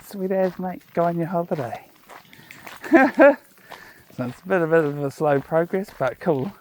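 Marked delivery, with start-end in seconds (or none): 0.0-1.9 laid-back broad New Zealand voice; broad kiwi accent. Retold or imitated. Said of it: "And I was like". 2.5-3.3 bright burst of laughter; a quick laugh, high and loud. Real, not acted. Laugh after retold reply.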